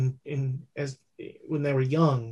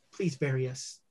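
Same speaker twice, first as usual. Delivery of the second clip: quiet plea; 4 semitones lower, 9 dB quieter than usual. Acted. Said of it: "and he says".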